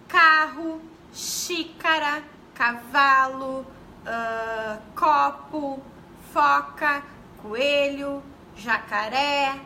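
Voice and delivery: each picture word spoken slowly and clearly; slow word list. Shown as a demonstration. Teacher reading bingo-card words to teachers, slow and clear. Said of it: "a gente coloca numa cartela lá".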